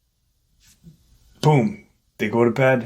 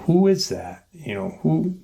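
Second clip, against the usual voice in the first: mildly mimicked, high-pitched puzzlement; retold startled question; 6 semitones higher than usual. Group looks around for whoever is screaming, retold startled question. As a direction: ask higher, imitating people searching around.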